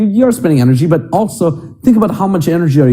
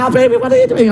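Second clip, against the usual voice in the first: put-on much higher, playful girlfriend voice; girly giggling voice; much faster than usual. Girlfriend laughing, dragged up.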